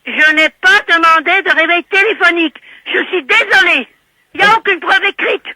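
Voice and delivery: loud, high, emphatic protest; real indignant insistence. Real, not acted. Woman telling the operator there is no proof, indignant protest. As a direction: hammer home the denial at high pitch.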